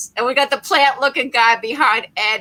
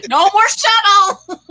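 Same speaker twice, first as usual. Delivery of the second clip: high, loud, drawn-out cry through laughter; loud mock announcement, laughing; laughing exclamation; 8 semitones higher, 7 dB louder, slower than usual. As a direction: crow it slowly while cracking up. Jokes about the shuttle exploding.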